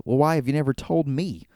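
retold confused questioning. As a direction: act out mild bafflement. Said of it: "just looks confused and goes".

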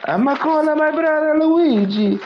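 very high squeaky cartoon voice; squeaky mock threat; Mario voice. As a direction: whine it out like a cartoon plumber. Speaks as Mario, riffing.

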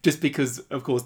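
ordinary talk

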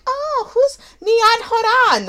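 high, slow mock-Swedish question; exaggerated Swedish mispronunciation of "[name]"; Swedish man's accent. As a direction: put on a puzzled Swedish accent, loud and drawn out. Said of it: "the Swedish guy was like".

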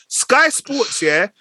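ordinary delivery

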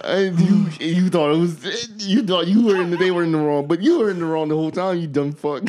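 high, loud, through fits of laughter; can barely get the words out for laughing; laughing hard. Real, not acted. Mocking the confused intruder while laughing hard. Cracking up at own joke.

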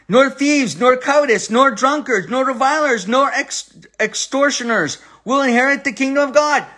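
high, ringing pulpit reading of the list; preaching scripture. Acted. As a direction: proclaim each nor like a verdict from the pulpit.

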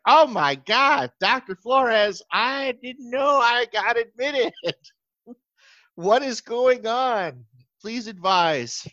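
high, slow giddy pleading; excited disbelief. Retold or imitated. Writing back to Doctor Flores in giddy shock, high and slow. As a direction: gush with stunned excitement. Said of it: "I was like".

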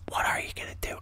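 hushed, voiceless aside; soft stage whisper; resigned whisper. Pretend. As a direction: whisper it like giving up. Third take, dropped to whisper.

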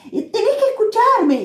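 high exasperated call; frantic plea; retold shout. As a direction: make her listen. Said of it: "Y yo digo".

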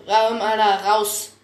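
loud, spat-out rebuke; snapped retort. Acted. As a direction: throw the words out like a hiss.

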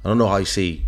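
ordinary talk

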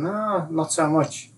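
slow, lilting "no" as if turning it down; reluctant no. Acted. Voicing the heart's reluctant no.

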